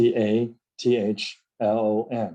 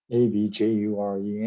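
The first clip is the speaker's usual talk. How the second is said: ordinary talk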